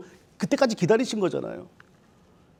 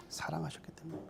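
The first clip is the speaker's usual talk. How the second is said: whispered repeat; 4 semitones lower, 21 dB quieter than usual. Acted. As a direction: whisper it, much softer. Repeated emotional point, whisper.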